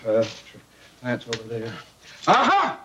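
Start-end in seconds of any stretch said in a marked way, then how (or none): 2.3-2.8 high, loud "Aha!"; triumphant discovery. Acted. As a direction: pounce on the find. Finds an inefficiency.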